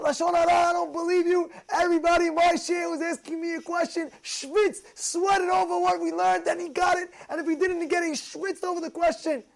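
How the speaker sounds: mock his indignant teacher; rabbi's indignant lecture, pitched high; imitating the rabbi. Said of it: "he's like".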